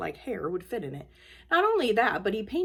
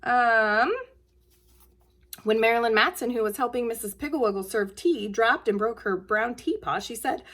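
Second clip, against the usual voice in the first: plain reading voice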